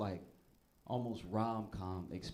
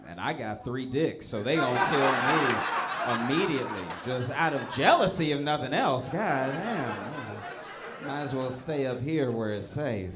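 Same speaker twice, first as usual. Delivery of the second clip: mock alien voice; 2 semitones higher, 10 dB louder than usual. Comic keeps the alien voice going.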